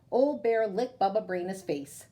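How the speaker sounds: ordinary delivery, nothing marked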